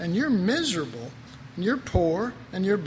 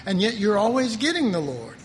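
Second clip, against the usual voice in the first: normal talking voice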